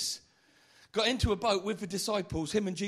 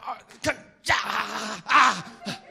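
loud strained groans rising to a shout; hammed-up mummy groans; groaning Lazarus; 2 semitones higher, 9 dB louder, much slower than usual. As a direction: groan like a man waking in grave clothes. Lazarus stumbling out of tomb.

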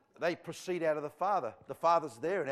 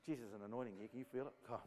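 much softer, lower voice; hushed, as if hit by the anointing; suddenly overcome; 5 semitones lower, 14 dB quieter, faster than usual. Real, not acted. Preacher feeling the anointing hit him, hushed and overcome. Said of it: "Just suddenly hit me".

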